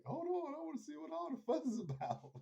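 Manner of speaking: joking mock Ellie; imitating Ellie. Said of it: "and she's like".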